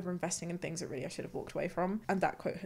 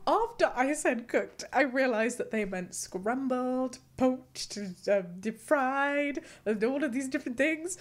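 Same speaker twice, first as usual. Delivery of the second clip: ordinary talk, nothing marked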